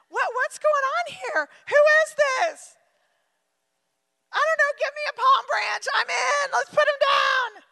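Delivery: high, loud, eager crowd voice; excitable crowd. Retold or imitated. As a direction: chatter like a swept-along crowd member. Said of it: "they said in verse ten".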